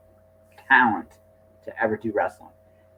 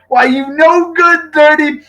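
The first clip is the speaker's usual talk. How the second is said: much higher, slow, hammed-up sputtering rage; mock outraged Heenan; 13 semitones higher, 6 dB louder, slower than usual. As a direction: splutter the insult like an outraged manager. Heenan raging at Jake.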